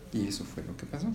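softly whispered close of the story; whispered ending. Real, not acted. Parent closing a bedtime story in a whisper.

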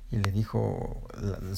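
ordinary delivery

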